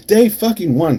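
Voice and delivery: loud, high burst on "Day fucking one"; outraged emphasis. Acted. Repeats the phrase, escalating.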